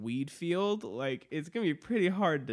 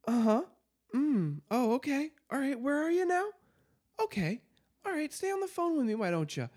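high, far-off calm dispatcher voice, much higher; 911 operator; 11 semitones higher than usual. Retold or imitated. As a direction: play a patient operator humoring a caller, pitched way up. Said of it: "the nine one one operator is just like".

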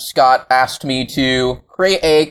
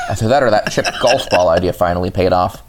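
normal talking voice

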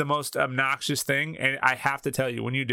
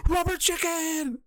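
mock fan yell. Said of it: "It's just something".

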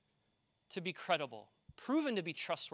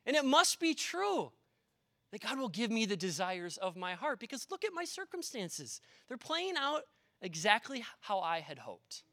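high, sing-song voice of easy faith; mock the comfortable believer, pitched up; smug fair-weather believer; 6 semitones higher than usual. Preacher mocking complacent churchgoers, high sing-song. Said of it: "it is easy to say".

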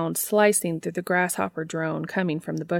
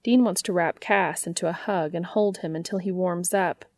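plain reading voice

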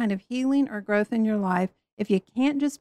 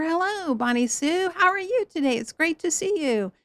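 higher, sing-song over-friendly hello; chipper mock greeting; 6 semitones higher than usual. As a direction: gush at Bonnie Sue like a church lady. Cheery churchgoer greeting Bonnie Sue.

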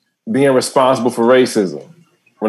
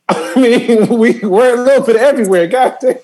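high, laughing words; cracking up at own line; real laughter breaking through; 9 semitones higher than usual. Real, not acted.

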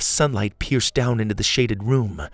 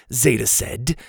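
ordinary delivery

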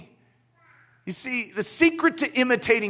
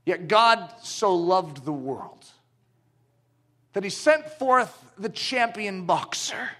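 ordinary talk

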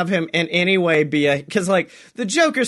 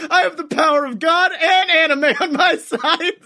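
unhinged proclamation; 9 semitones higher than usual. Retold or imitated. Henchman rising after Logan Paul is shot, crazed laughing shout. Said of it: "sits up and goes".

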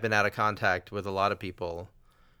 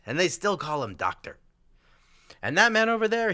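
raised, lightly voiced old man boasting; old man's voice; 11 semitones higher than usual. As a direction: pitch the old man up and keep it there to the punchline. Old man's punchline in a joke.

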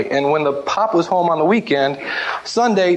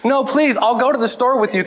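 put-on pleading kid; 6 semitones higher than usual. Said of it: "And I was like".

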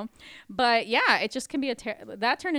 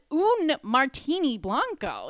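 slow, loud, raised-pitch quote; emphatic incredulous quoting; 6 semitones higher, 6 dB louder, slower than usual. Retold or imitated. Bartender proving to coworker she spoke Spanish, incredulous. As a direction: hammer out each foreign word in disbelief.

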